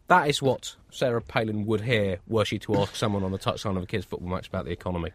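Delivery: normal talking voice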